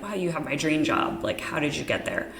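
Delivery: usual speaking voice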